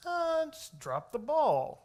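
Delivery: high, slow, lazy shrug of a voice; mock careless voice.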